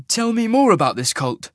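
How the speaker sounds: a bold traveller hailing a wagon master; Cole's voice. Acted. Narrator voicing Cole hailing the teamster, brisk and loud.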